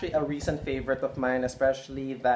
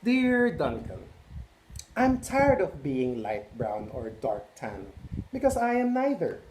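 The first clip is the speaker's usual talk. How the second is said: proud, slighted beige crayon. Acted. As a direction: sound hurt but proud of being beige. Beige Crayon's letter read aloud.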